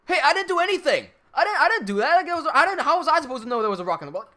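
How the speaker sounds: defensive protest. Retold or imitated. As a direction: protest your innocence shrilly. Guy protesting his innocence.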